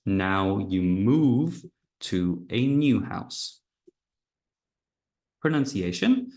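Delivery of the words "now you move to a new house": slow, with new stressed; now you move to a NEW house, slowly. Shown as a demonstration. Teacher contrasting old house and new house. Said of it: "In the past, you were in an old house".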